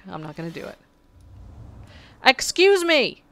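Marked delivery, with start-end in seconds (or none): 2.2-3.2 sharp high startled exclamation; indignant excuse me. Real, not acted. Player interrupted while crafting, indignant shout. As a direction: snap it out, interrupted mid-task.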